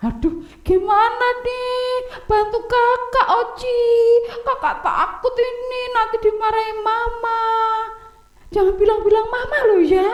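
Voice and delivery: high child's voice, mock fretful and pleading; scared big brother. Oni begs Oci to keep the secret, high and fretful. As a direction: beg your little brother not to tell. Kakak takut.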